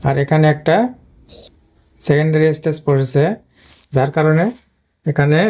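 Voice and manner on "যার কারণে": ordinary talk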